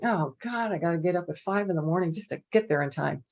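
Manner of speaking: usual speaking voice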